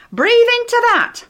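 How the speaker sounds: high, loud command; firm instruction. Retold or imitated. Edith holding the bag to Eric's mouth, loud firm command. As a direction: take charge and tell him firmly. Helping him with paper bag.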